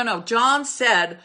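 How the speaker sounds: normal talking voice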